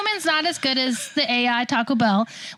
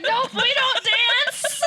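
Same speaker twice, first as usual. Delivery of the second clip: high, slow, laughing through the no; laughing refusal; 11 semitones higher, much slower than usual. Retold or imitated. Staff laughing at the drive-thru window, high slow refusal. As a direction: giggle out a refusal, drawn slow. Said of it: "and they're like".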